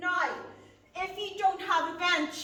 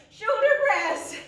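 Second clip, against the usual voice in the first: laughing; 5 semitones higher, 5 dB louder, slower than usual. Real, not acted. Laughs at forgetting something.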